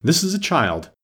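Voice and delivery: high-pitched, eager introduction; keen, high announcement. A narrator's reading. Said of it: "Haya replied eagerly".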